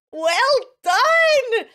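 high, loud cheering well done; bright teacher's cheer; excited praise. Real, not acted.

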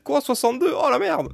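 mock shock. Mock American shocked at retiring at sixty-two, squealing high. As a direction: squeal it like you can't believe sixty-two.

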